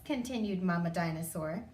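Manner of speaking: usual speaking voice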